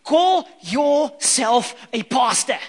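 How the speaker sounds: offended disciple snapping back at Jesus; indignant retort. Retold or imitated. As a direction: drag it out, mock offended. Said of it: "I'm like".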